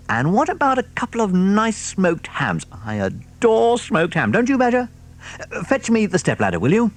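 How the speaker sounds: high, relishing fox voice; delighted fox. Acted. Mister Fox choosing food, high delighted fox voice. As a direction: savour every treat aloud.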